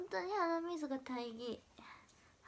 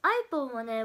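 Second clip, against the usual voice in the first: ordinary talk, nothing marked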